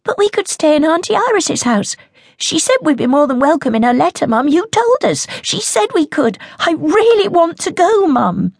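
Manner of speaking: put-on high, eager, pleading girl; begging child.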